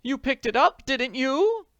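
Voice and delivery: very high, loud panic; alarmed. Acted. Potter to Jane about bag, panicked questioning. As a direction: ask in panic.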